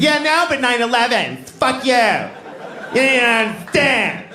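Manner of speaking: high, petulant whine; defensive America. Acted. America as girlfriend dismissing critics, high petulant whine. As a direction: whine the excuse like a spoiled girlfriend.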